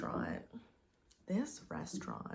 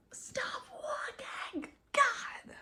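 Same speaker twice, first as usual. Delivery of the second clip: exasperated yell; 4 dB louder, much slower than usual. Real, not acted. Yelling at someone off-camera.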